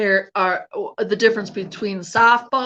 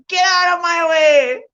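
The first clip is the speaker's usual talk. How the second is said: mock yell; 5 semitones higher than usual. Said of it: "I'm like".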